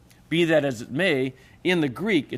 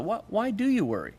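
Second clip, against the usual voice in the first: probing question; 6 semitones higher, 3 dB quieter than usual. Acted. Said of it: "I want you to think about it".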